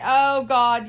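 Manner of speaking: loud, thrilled outburst; delighted exclaim. Real, not acted. Knitter to viewers remembering the yarn gift, loud and delighted. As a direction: blurt it out with joy.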